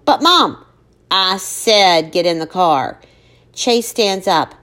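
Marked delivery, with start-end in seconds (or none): 0.0-0.6 high, loud protest. Acted. Daughter protesting. 1.1-3.0 slow, firm command; stern. Acted. Mother repeats order sternly.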